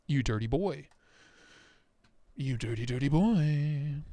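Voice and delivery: low teasing purr. Pretend. Teasing the knife.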